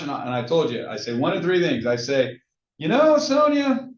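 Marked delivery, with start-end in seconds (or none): 2.8-3.9 high, drawn-out, teasing lead-in; playful upbeat answer. Shown as a demonstration. Agent answering how he is, playfully upbeat. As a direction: stretch it out high, as if about to confide.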